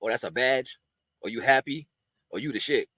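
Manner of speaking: low sarcastic inner voice; unspoken retort. Retold or imitated. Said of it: "in my head, I be wanting to say".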